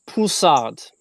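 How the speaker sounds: put-on French accent on the wine name. Shown as a demonstration. Wine lover showing off a grape name to viewers, French accent. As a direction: show off a French sommelier accent. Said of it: "trying to do this French accent".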